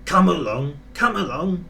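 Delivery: a touch of rude impatience; rude call. A narrator's reading. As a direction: order them along curtly. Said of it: "he called rudely".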